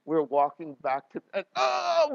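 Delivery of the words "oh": high, loud wail of pain; pained cry. Retold or imitated. Pastor reenacting his cramped legs for the congregation, pained cry. Acts out leg cramp agony.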